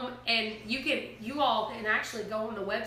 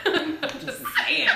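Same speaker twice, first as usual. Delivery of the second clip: laughing; 9 semitones higher, 6 dB louder, much slower than usual. Real, not acted. Repeats it laughing.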